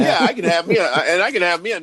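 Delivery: laughing through the words; loud, high laughing line. Real, not acted. Laughing while joking about home evening.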